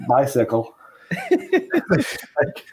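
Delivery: lightly cute after the anger; cute, laughing. Retold or imitated. Comedian retelling the voicemail's ending, cute and laughing. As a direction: sweetly sign off through a laugh. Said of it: "to cute".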